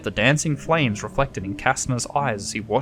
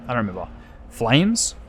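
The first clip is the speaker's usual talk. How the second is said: ordinary delivery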